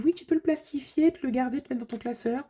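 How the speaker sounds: high, squeaky voice for her daughter; mock little-daughter squeak; squeaky little girl voice. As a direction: pipe it up like a small child. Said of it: "je fais une petite voix de crécelle".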